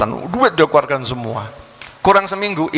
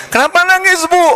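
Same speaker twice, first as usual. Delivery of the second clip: put-on alarmed young man; 4 dB louder than usual. Young man voiced asking crying mother.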